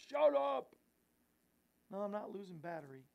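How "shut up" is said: louder, higher mock voice of the Lord; mock divine rebuke. Host voicing the Lord telling him to hush, mock boom. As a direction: boom it out like heaven scolding. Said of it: "the Lord just saying".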